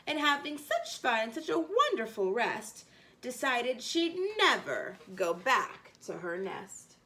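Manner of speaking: ordinary delivery, nothing marked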